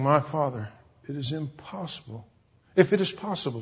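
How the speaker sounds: hushed reading of an agonized prayer; subdued acted prayer. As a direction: read it as a quiet, heavy-hearted prayer. Reads Jesus' prayer in Gethsemane.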